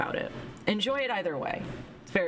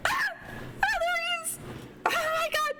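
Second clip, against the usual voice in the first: excited shriek. Real, not acted. Streamer sees the twin character and shrieks, excited. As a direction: shriek with delight, much higher. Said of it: "Sorry, I'm excited".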